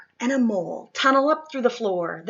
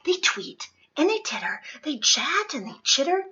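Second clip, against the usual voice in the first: fast, hushed bustling read; quick whispered narration; 4 dB quieter, much faster than usual. A narrator's reading. Narrator whispering fast as animals crowd in.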